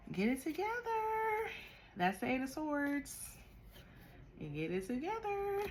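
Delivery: high, teasing "get it together"; sing-song self-scolding. Real, not acted. Tarot reader teasing herself over a card mix-up. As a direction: chide yourself in a high playful voice.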